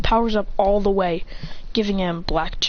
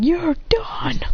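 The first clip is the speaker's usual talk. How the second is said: high, strained finishing line; mock battle cry; acted battle threat; 8 semitones higher than usual. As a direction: declare it high, like an anime fighter. Character declares victory.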